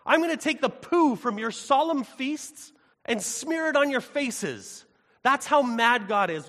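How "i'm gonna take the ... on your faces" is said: much higher and louder, slow, outraged divine voice; angry God. Acted. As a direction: thunder it out as a wounded, furious God. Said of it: "He says".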